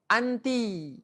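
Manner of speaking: model word reading. Shown as a demonstration.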